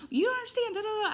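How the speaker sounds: very high-pitched whiny rant; mock complaining. Ranting about work while lost in her own head, mock complaining. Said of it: "And I'm, like, complaining".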